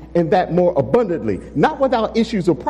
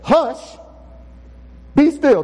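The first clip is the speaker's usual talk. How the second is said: commanding; 7 semitones higher, slower than usual. Acted. Sovereign Jesus standing to rebuke the storm, commanding. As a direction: command the storm with full authority. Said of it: "he stands up and he says".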